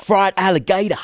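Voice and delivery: high, hammed-up Aussie voice; amused mock-Australian twang; Australian accent. Offering Aussie food to the co-host, Australian accent. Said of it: "you've got very good Australian accent".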